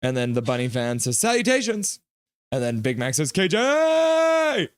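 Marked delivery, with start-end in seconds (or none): 1.2-2.0 high, lifted hello; chirpy greeting. Acted. Streamer voicing a fan greeting a newcomer, chirpy greeting. 3.3-4.7 high, held greeting shout; hailing yell. Acted. Streamer voicing chat greeting a newcomer, high yell.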